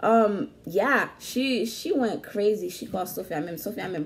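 ordinary talk, nothing marked

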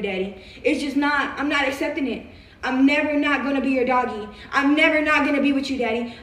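normal talking voice

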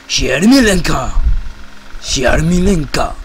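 slow made-up Japanese name; fake Japanese. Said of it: "en mi japonés inventado".

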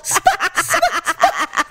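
high, broken blurts; panicked sputtering. Retold or imitated. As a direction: sputter one stuck word in panic. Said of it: "I couldn't even say it".

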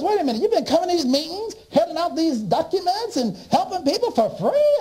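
mock astonished boss; boss in disbelief. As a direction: rattle it off, astonished.

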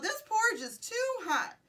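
high, fairly loud storybook shout; shouted complaint. A narrator's reading. Goldilocks complains about the hot porridge, shouted. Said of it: "She shouted".

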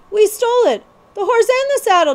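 high, loud, hurried plea; desperate. Acted. Jemmy desperately lies to the outlaws, high and hurried. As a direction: blurt it out to cover the truth. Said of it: "Jemmy put in desperately".

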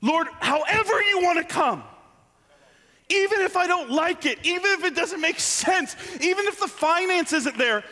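high, urgent surrender in prayer; fervent prayer. Acted. Preacher praying for God to come any way, fervent.

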